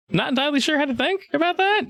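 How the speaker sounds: high, quick, hesitant reply; wary uncertainty. Acted. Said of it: "He's just kinda looking at you like".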